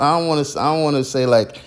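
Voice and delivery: ordinary talk, nothing marked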